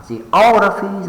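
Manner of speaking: geography with OG high, loud and held; ge-OOG-raphy, punched high on OG; stress on second syllable. Shown as a demonstration. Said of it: "the second one".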